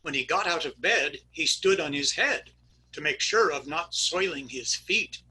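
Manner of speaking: ordinary talk, nothing marked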